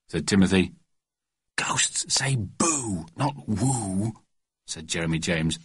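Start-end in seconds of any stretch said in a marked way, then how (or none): 1.6-4.1 slow, soft, half-whispered; hushed correction. Acted. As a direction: correct him under your breath, taking your time. Boys whispering in dark tent.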